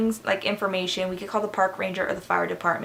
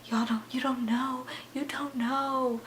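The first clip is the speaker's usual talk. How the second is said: drawn-out, higher mock-frightened whisper; scared whisper; 5 semitones higher, 8 dB quieter, slower than usual. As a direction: whisper it slowly, pretending to be spooked. Acted scared reply to Frank.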